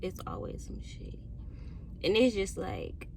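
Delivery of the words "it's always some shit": very soft, nearly voiceless repeat; resigned aside. Real, not acted. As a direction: say it under your breath, to yourself. Weary repeat to herself after sighing.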